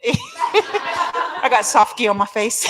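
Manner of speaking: slow words broken by real laughter; laughing. Real, not acted. Laughing at her teary face to the crowd, slow and loud. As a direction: laugh through it at her own tears.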